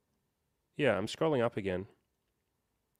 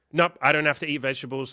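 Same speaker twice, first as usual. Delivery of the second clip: defensive retort; 5 semitones higher, 7 dB louder than usual. Retold or imitated. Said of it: "my first instinct will be like".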